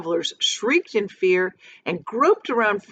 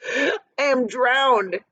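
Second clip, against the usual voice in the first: put-on slow, higher, gasping old voice; gasping grasshopper; 6 semitones higher, much slower than usual. A narrator's reading. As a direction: gasp it out half-drowned. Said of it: "gasped the old green grasshopper".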